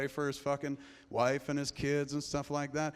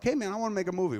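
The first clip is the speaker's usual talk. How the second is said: eager dreamer; 8 semitones higher, 6 dB louder, much faster than usual. Acted. Acted hopeful dreamer pitching an idea.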